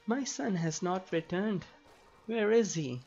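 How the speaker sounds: imagined worried parent. Pretend. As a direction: lightly play a parent fretting for a child. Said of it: "just being worried sick about".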